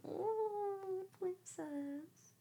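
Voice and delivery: high sweet coo to the cat. Real, not acted. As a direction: croon it to your pet. Cooing at her cat.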